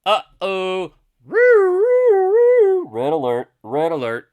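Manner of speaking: loud, high siren wail into warning; drawn-out loud klaxon imitation; mock alarm. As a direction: wail like a siren, then call out. Sounds a mock alarm at chart.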